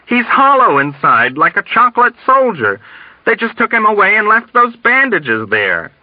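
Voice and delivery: high, frantic explanation; shouting back. A narrator's reading. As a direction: insist on it in agitation. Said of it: "Dunbar shouted back".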